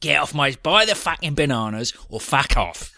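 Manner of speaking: loud mock East End accent; East End market trader. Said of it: "like some market trader down".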